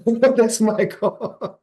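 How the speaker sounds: laughed out high and slow through a grin; laughing. Real, not acted. To the host about his goal, laughing. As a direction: let the laugh carry the words up.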